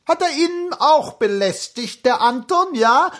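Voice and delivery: high-pitched, confiding cloakroom woman; chummy chatter. A narrator's reading. Cloakroom woman asking the prince about Anton, chummy chatter. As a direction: chatter familiarly, pitched well up. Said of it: "zutraulich schwatzend".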